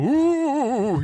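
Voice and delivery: high, loud ghostly ooh; ghost noise. Shown as a demonstration. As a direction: moan like a cartoon ghost. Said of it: "I really like to make a ghost noise".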